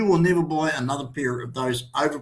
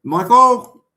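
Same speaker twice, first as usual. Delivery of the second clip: calling out; 7 semitones higher, 9 dB louder than usual. Real, not acted. Host calling out to Michael in the studio. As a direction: call the name out loud and high.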